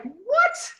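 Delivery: high stunned squeak of disbelief; amazed what. Retold or imitated. Hearing the toothpick trick from a customer, stunned and squeaking high. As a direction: shoot the pitch way up in pure disbelief. Retelling her amazed reaction.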